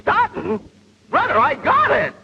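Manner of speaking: high, excited crowing; triumphant exclamation. Acted. Trainee answers instructor's faint praise, triumphant exclamation. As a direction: burst out cocky and high-pitched.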